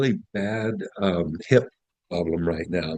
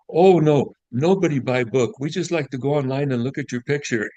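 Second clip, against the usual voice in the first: put-on Russian accent; 6 semitones higher than usual. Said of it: "really lovely little Russian lady".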